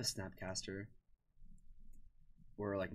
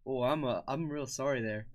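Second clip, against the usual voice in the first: put-on Canadian accent, higher and louder; hammed-up Canadian apology; 5 semitones higher, 7 dB louder than usual. Shown as a demonstration. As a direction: play an apologetic Canadian, lifted pitch. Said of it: "I can't do a Canadian accent".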